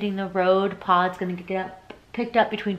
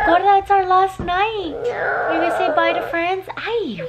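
put-on baby talk; 10 semitones higher than usual. Mom cooing to baby.

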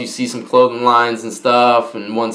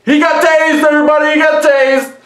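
excited hollering; 12 semitones higher than usual. Retold or imitated. Inmate announcing a tasing to everybody, hollering. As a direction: call it out high and stretched to the whole tier. Acts out hole's uproar over tasing.

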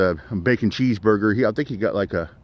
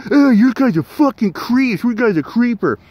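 mocking the woman; 12 semitones higher, 4 dB louder than usual. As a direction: drag out her outraged voice, higher and louder. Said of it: "and she".

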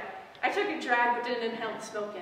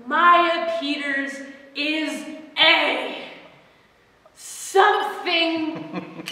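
slow reading; 5 semitones higher, 9 dB louder, much slower than usual. Acted. Reading the scratched graffiti.

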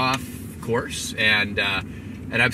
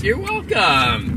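high, loud, drawn-out singsong; hammed-up welcome; 9 semitones higher, 6 dB louder, much slower than usual. Guest thanked again by the host, hammed-up. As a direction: stretch it out with exaggerated courtesy.

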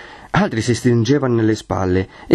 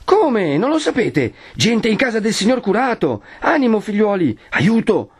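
high-pitched urgent cry for help; raising the alarm; 12 semitones higher than usual. Acted. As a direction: rouse the crowd to action. Bell-ringer rouses crowd to help. (grido d'allarme acuto)

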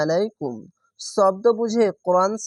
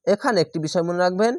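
ordinary talk, nothing marked

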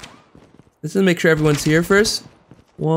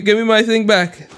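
ordinary delivery

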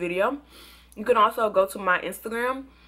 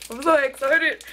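excited; 7 semitones higher, 5 dB louder than usual. Real, not acted. Trying a new treat on camera.

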